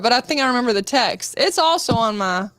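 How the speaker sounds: normal talking voice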